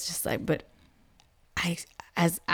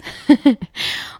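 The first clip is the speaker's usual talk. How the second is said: short, higher self-conscious laugh; embarrassed laugh; 7 semitones higher, 5 dB louder than usual. Real, not acted. Mother admitting she becomes a kid with kids, embarrassed laugh.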